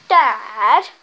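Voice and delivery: yelling across the room. Real, not acted. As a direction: call out loud across the house. Calling dad from downstairs.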